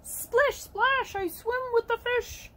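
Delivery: high, playful storybook rhyme; playful duckling. Acted. Ducky telling children about swimming, high playful voice. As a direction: chant it high like an excited little duck.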